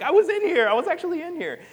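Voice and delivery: high, loud disbelief; shocked realization. Retold or imitated. Saul realizing David was in the cave, shocked and loud. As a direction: gasp out the discovery.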